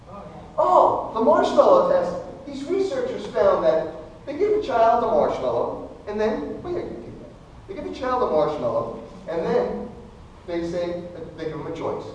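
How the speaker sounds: normal talking voice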